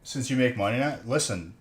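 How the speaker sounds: ordinary talk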